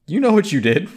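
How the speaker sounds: high, loud knowing accusation; mock accusing. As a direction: accuse her, all-knowing.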